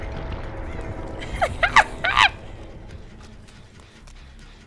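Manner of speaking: high squealing laughter; breathy start into high giggles; nervous laugh. Real, not acted. Nervous laughter while hiding.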